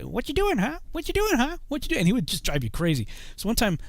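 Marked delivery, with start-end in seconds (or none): 0.0-2.0 much higher squawky parrot imitation. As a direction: mimic the talking parrot, high and nagging. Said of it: "and he always say, like".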